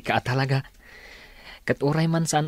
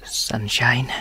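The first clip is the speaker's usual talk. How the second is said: whisper; 2 semitones lower than usual. Acted. Whispered name.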